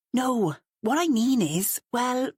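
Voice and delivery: exasperated. Acted. Matt backtracking on the phone, exasperated and flustered. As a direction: stumble over the words, irritated. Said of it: "his exasperation was clear and stinging".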